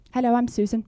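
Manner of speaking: timid contestant. Acted. Switches to the shy contestant.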